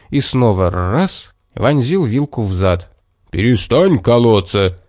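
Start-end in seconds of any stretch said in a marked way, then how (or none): 3.3-4.8 a touch of a growling bear; understated angry bear; mild bark. A narrator's reading. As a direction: snap it at the unseen attacker. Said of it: "рявкнул медведь".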